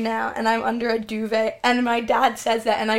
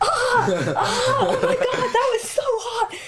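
high, laughing squeal over hot tea; laughing yelp of pain; 11 semitones higher than usual. Retold or imitated. Retelling to a friend the hot tea spill, laughing high yelps. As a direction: yelp it out through laughter, as if scalded. Retold burning self with tea.

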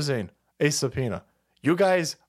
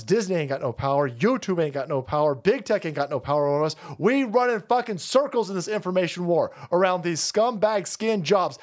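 usual speaking voice

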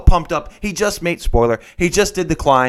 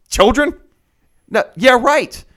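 high, loud mock disbelief; scoffing; 7 semitones higher, 5 dB louder, slower than usual. Climber dismissing girlfriend's dream of children, scoffing.

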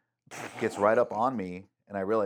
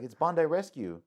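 higher imitation of an Australian lifeguard; an Australian accent, pitched higher; 9 semitones higher, 4 dB louder than usual. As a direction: announce the show name like a proud Aussie. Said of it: "Literally, the whole accent".

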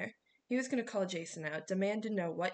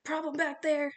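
high, loud challenge from the front; raised voice, calling to the back; coach yelling; 7 semitones higher, 6 dB louder than usual. A narrator's reading. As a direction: yell it like a suspicious coach. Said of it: "Coach Hedge yelled from the front".

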